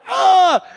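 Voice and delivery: short high yelp of pain; mock pained yelp. Pastor retelling landing on his toe, high yelp.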